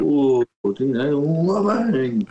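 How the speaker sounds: put-on high, slow sarcastic teen; backhanded teen voice. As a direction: drawl it like a cheeky teenager. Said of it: "Backhanded comments".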